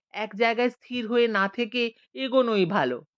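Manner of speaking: usual speaking voice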